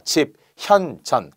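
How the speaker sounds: 집, 현, 전 with a clear pause after each syllable; broken into syllables. Shown as a demonstration. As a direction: say 집, 현, 전 separately, keeping the h. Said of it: "끊어서".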